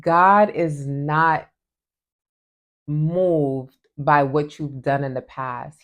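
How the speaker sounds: slow and weighty, leaning on God; a deliberate, emphatic slow build; slow emphatic statement. Real, not acted. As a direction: preach it slowly, word by word. Slow emphatic preaching point.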